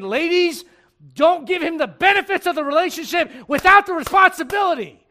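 loud, high preaching shout; forceful exhortation. Real, not acted. Preacher warning the ladies about relationships, shouted exhortation. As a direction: drive every phrase home at full force.

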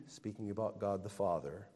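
normal talking voice

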